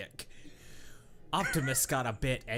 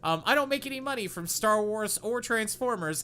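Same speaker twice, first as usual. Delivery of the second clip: high excited aside; 7 semitones higher, 5 dB louder than usual. Real, not acted. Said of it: "aside to viewers".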